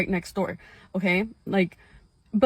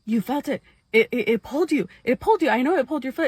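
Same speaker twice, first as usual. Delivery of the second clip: scared sister; 5 semitones higher, faster than usual. Retold or imitated. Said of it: "she's like".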